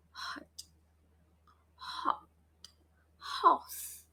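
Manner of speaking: "Hot" and "Horse" begun with a puff of air; hushed H words pushed out on breath; airy H. Shown as a demonstration. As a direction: breathe out hard on the H, almost voiceless. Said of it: "The air will touch our".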